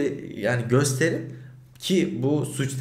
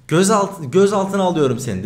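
stern raised voice of a cop making an arrest threat; louder, higher, bossy police order; retold cop threat; 6 semitones higher, 6 dB louder than usual. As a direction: bark it like an officer laying down the law. Cop threatening to detain him.

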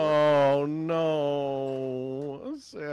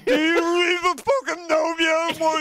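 dramatic shout; 12 semitones higher, 8 dB louder than usual. Acted. Mock dramatic outburst.

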